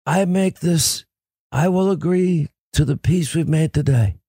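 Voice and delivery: strained, halting Godfather impression; solemn mob boss warning, laboured and put-on; Marlon Brando. Host as the Godfather making peace with a warning, strained movie impression. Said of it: "you like my Marlon Brando".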